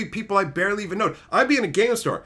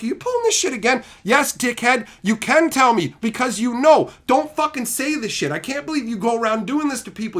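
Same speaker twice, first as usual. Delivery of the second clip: raised, higher-pitched scolding, replayed; acted indignant confrontation; 6 semitones higher, 4 dB louder than usual. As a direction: lay into him, retelling the showdown. Replaying angry confrontation with repeat offender.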